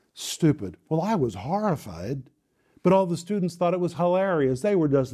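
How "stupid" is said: imitated spat-out STUPID, dropping low; low, scornful name-calling; spitting contempt. Teacher naming the pupil before the class, spitting contempt. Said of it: "spitting as she called me".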